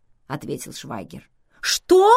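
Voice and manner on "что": very high, loud outraged question; indignant. A narrator's reading. Vivian hears they were in a bar, exclaims indignantly. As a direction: burst out in indignation. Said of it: "возмутилась Вивиан".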